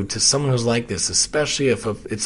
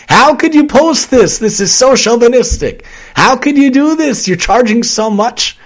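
high, loud shrill accusation; outraged accuser; 10 semitones higher, 7 dB louder than usual. Retold or imitated. Angry client accusing him over a post, high and loud. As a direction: hurl the complaint like an offended stranger.

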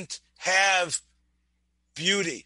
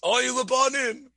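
higher, mournful Oy Lebanim; lamenting cry; 5 semitones higher than usual. Acted. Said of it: "what you hear is".

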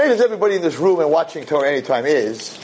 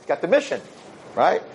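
ordinary delivery

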